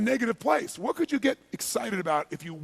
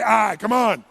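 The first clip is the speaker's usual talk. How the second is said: loud call to the crowd; rallying call; 7 dB louder, slower than usual. Real, not acted. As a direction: fire up the room for a response. Rallying the audience to respond.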